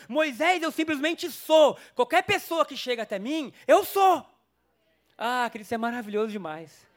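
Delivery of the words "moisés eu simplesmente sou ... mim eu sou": higher and louder, peaking on the last eu sou; God's proclamation. Acted. God declaring his name to Moses, rising to a shout. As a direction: proclaim it like thunder. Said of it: "Deus responde o nome dele".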